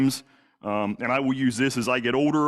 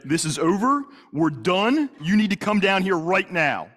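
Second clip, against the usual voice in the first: retold angry yelling; 8 semitones higher than usual. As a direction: yell the command, patience gone. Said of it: "Derek, stop yelling at me".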